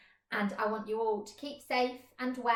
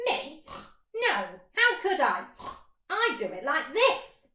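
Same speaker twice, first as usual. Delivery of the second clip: higher, louder pig voice; 5 semitones higher, 5 dB louder than usual. Acted. Pig answering the mole, high and loud. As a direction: grunt it out like a pig.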